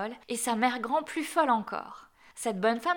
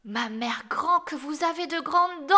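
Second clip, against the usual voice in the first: put-on astonished child; 6 semitones higher, 4 dB louder than usual. A narrator's reading. Child alarmed at wolf's teeth.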